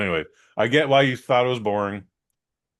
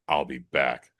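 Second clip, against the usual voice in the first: lightly imitated, low and menacing line; Terminator; 9 semitones lower, slower than usual. Retelling the man's exit past him, low Terminator-like delivery. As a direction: drop low for a hint of movie cyborg. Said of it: "He tried to terminate you?".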